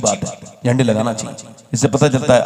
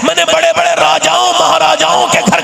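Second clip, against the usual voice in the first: fervent high praise; 4 dB louder than usual. Acted. Man praises the food, rising fervor.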